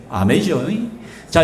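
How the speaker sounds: ordinary talk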